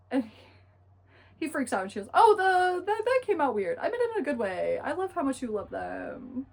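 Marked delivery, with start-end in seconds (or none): 2.1-6.5 high, loud mock-flustered cover-up; flustered backpedalling. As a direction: hurriedly take it back, pitched up. Acted backpedal after he freaks out.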